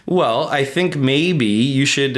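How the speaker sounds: usual speaking voice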